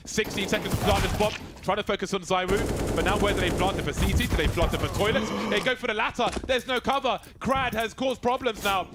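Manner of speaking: high, urgent match commentary; tense play-by-play. Real, not acted. Caster calls the bomb plant, tense play-by-play. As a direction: build the tension over the countdown.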